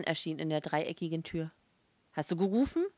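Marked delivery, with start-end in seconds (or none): 2.1-2.9 mildly irritated question, raised at the end. A narrator's reading. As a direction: ask with a touch of annoyance. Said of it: "fragte er gereizt".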